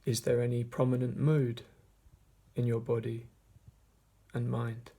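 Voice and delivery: measured meditation voice with long pauses; guided meditation. Acted. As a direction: guide listeners gently inward, leaving long silences between phrases. Leads a meditation.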